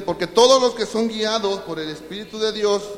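slow, loud, high preaching of the verse; fiery scripture reading. Acted. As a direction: proclaim the verse to the congregation, drawn out. Reads the verse at full force.

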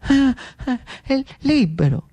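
halting reply starting high, then settling; stammer, then let the word out; hesitant Lucio. Acted. Lucio answering Riccardo how he feels, hesitant high start.

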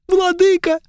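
put-on high, pleading young elf; whiny elf. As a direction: beg high and tearful.

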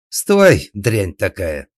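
high, very loud first word; shrieked order dropping into an insult. A narrator's reading. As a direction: squeal furiously to stop her. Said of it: "взвизгнула сеньора Тереза".

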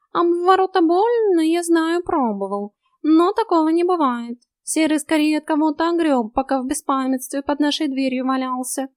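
high, loud cat voice; knowing talking cat. A narrator's reading. Cat explains the wolf's wound to heroine, high and knowing. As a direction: chatter high as an opinionated cat.

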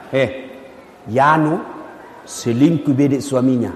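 low, hushed gossip with a giggle; gossiping woman. Retold or imitated. Neighbour gossiping about an absent woman, low giggling whisper-gossip. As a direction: share the scandal behind her back. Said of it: "Ibu-ibu, ada tetangga tak datang".

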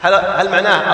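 normal talking voice

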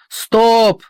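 sharp high shouted halt; officer shouting. A narrator's reading. Officer on armoured train shouts to stop. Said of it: "кричит офицер".

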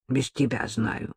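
low-key, slow grumble under the breath; grumbling. A narrator's reading. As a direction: grumble it softly, dragging the words. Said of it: "проворчала Маша".

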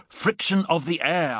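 high, drawn-out exclamation; shouted repeat. A narrator's reading. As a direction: raise the pitch to be understood. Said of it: "I shouted".

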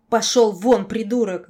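very loud angry shout; furious yell. A narrator's reading. Girl ordering the looming lord out, yelling. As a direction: yell to drive him away. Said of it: "заорала я".

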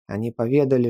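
plain reading voice

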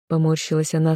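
usual speaking voice

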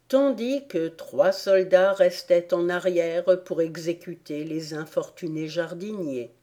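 ordinary delivery, nothing marked

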